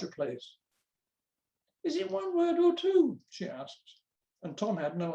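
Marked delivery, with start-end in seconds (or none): 1.8-3.2 high-pitched schoolmistress voice; teacher's questioning voice. A narrator's reading. New teacher from near London asks Tom, high questioning voice. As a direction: ask it high, as a puzzled teacher.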